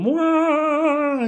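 very high held "whoa", sliding down at the end; Mario death wail. Retold or imitated. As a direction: imitate a game character falling to its death. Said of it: "that's the Mario death sound".

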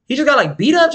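disbelieving retold reaction; incredulous question. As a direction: question her in disbelief, pitch climbing to the end. Questioning her story in retold talk.

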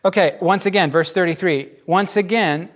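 usual speaking voice

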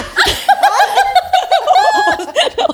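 loud, high burst of laughing; real laughter. Real, not acted. Mother cracking up at her own trauma-face joke, loud real laughter.

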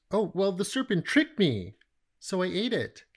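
mock Eve shifting blame onto the serpent; half-hearted excuse. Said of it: "Another half-hearted confession".

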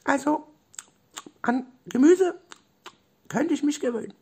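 very high cartoon critter voice; high-pitched little creature, pausing as it thinks; squeaky animal character. A narrator's reading.

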